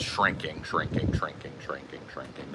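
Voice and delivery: fading down to a low, near-inaudible murmur; shrinking voice. Shown as a demonstration. Voice shrinks with the word.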